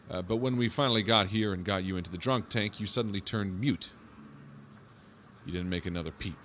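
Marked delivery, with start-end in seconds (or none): none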